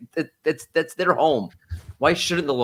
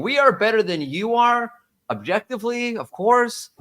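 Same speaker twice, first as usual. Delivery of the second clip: smug mock boast; 8 semitones higher than usual. Mimicking Yelp's boast.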